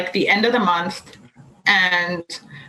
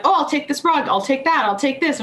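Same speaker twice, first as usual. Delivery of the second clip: higher, keen buyers; eager customers; 6 semitones higher than usual. Retold or imitated. Happy clients choosing rugs, higher eager voice. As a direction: pick things off the shelf gladly. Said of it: "So they were like".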